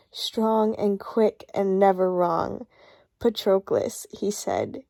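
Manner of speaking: slow, tender reading of a novel passage; reading aloud. Acted. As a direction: read it slowly, savouring each line. Reads the book passage aloud.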